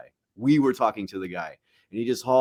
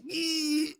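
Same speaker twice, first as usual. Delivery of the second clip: high squeaky yee; 6 dB quieter than usual. Retold or imitated. Imitating movie character grunt.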